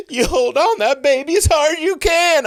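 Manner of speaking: very high, loud shriek; imitated frantic screech; panicked yell. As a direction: scream an urgent order as a terrified woman. Terrified pilot's wife in retold crash.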